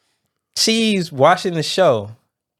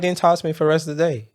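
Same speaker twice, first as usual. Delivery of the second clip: ordinary talk, nothing marked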